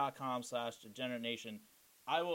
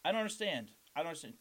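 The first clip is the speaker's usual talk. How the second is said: usual speaking voice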